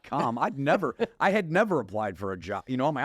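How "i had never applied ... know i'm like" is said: ordinary talk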